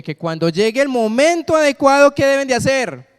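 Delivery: emphatic high-pitched rallying call; rousing preaching. Real, not acted. Preacher builds toward the shout.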